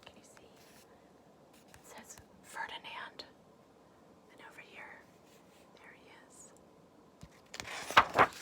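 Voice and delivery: hushed whisper showing the picture; whispered aside. Real, not acted. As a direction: lean in and whisper, pointing at the page.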